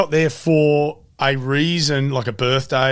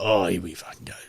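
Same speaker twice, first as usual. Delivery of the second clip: hungover groan; 6 semitones lower, 7 dB quieter than usual. Retold or imitated. Hungover dad groaning to his wife about the morning. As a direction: lower and softer hungover groan.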